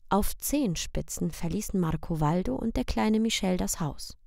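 usual speaking voice

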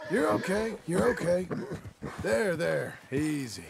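low, gentle soothing murmur; shakily calming oneself down. Acted. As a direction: hush a frightened horse softly. Soothing a skittish horse.

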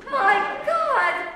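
high, loud cry of shock; woman's cry. A narrator's reading. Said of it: "cried one woman".

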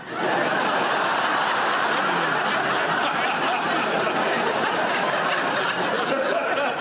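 loud breathy laugh. Real, not acted. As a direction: burst out laughing. [name] laughs after her story.

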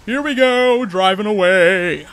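loud, triumphant whoop at the escape; triumphant shout. Real, not acted. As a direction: cheer as the team drives off.

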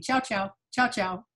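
"Ciao, ciao, ciao, ciao" tossed off fast in pairs; quick double ciao. Shown as a demonstration. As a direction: rattle off "Ciao, ciao" twice quickly. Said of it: "they said it twice quickly".

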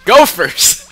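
shouted gophers; loud. Retold or imitated. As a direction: echo the cry loudly. Echoing game character.

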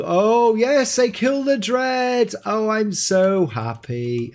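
high, loud, slow cheer; real gleeful triumph; delighted. Real, not acted. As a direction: cheer as the hated mobs die. Sharks kill hated creature.

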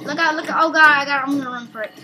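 high loud panicked yelling; being chased. Pretend. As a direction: yell like zombies are right behind you. Fleeing zombies in game.

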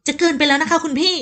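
high, loud offended retort; indignant protest. Acted. Mother defends her son to the elder sister, indignant. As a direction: push back hard at the elder sister.

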